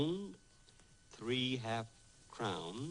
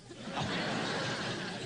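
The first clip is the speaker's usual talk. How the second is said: sheepish laugh. Acted. Tutor scolds him for peeking.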